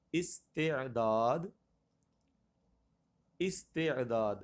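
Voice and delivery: استعداد syllable by syllable, "is-te-daad"; correct pronunciation. Shown as a demonstration. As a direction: say استعداد drawn out with long final "daad". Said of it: "اعراب کا تلفظ".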